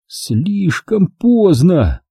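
high, very drawn-out words; dismayed realization. Acted. Jack sees the flagpole too late, slow dismayed realization. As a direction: stretch it out as disaster looms.

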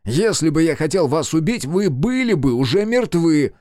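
high, loud exasperated shout. Acted. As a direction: yell to stop their panic. Shouting at fleeing people.

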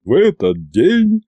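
rolling, slow orator's delivery; Иван. A narrator's reading. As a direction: roll the words out slowly. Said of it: "продолжил раскатистым басом Иван". (раскатистая неторопливая речь)

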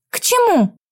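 high confused question; baffled, asking back; puzzled. Acted. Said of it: "не поняла я". (недоумённо)

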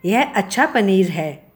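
usual speaking voice

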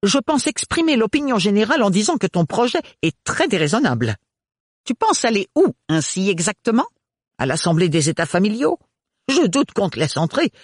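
high, fast, sniffy aunt voice; a stiff aunt lecturing a girl; prim aunt, disapproving. A narrator's reading. Aunt Roselyne scolds Ophélie's plan, high and prim.

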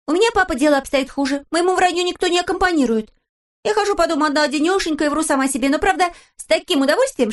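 put-on high, bright little girl; lively young daughter; cheeky girl voice. A narrator's reading. Pippi voiced in story. (голос девочки)

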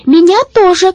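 put-on high, loud child's cry; shrieky-high, excited plea; eager shout. A narrator's reading. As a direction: call out to be included. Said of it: "крикнула Врони".

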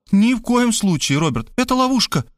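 high urgent protest; protesting woman. Acted. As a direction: object urgently, high-pitched. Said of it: "запротестовала".

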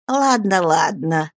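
plain reading voice

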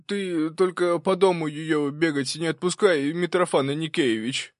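put-on old servant's anxious plea; pleading. A narrator's reading. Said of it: "взмолился Прохор".

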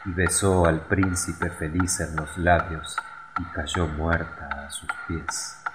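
plain reading voice

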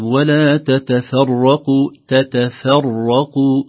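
slow chant, "تتفرقوا" thin until the heavy rā; light tā and fā. Shown as a demonstration. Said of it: "الفاء قبل الراء". («تتفرقوا» بترقيق التاءين والفاء)

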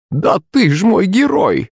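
high, slow doting granny; joyful old woman. A narrator's reading. Granny praising her hero, joyful. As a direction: stretch it out with grandmotherly delight. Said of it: "В голосе старухи появились нотки радости".

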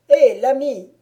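loud mocking hail; a loud, taunting call upward; calling out again. Baron calls again to the silent woodcutter, loud mocking hail. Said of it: "reprit le baron".

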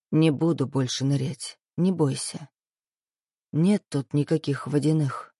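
soft, low reassuring voice; soothing. Acted. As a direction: gently comfort a crying girl. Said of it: "утешал парень". (тихое ласковое утешение)

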